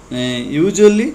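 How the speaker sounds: ordinary delivery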